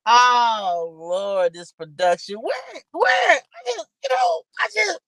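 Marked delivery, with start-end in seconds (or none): 2.4-5.0 high, strained yelling at the tech trouble; exasperated high yell; frustrated shout. Real, not acted. Frustrated by broken stream audio.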